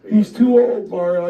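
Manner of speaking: high, loud exasperated insistence; retold angry boss. Chief repeats his verdict to wrestler, exasperated yell. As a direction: hammer it home as the fed-up boss.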